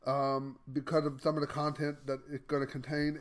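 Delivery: normal talking voice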